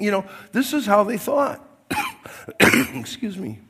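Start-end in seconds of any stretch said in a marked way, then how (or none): none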